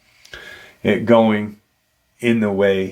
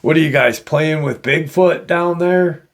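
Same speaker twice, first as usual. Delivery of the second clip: higher-pitched, mock-amused pilot; retold scoffing laugh; 6 semitones higher than usual. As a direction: tease them with a slight laugh in it. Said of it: "the helicopter pilot kinda laughs".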